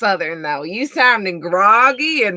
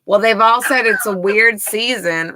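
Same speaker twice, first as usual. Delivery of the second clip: ordinary delivery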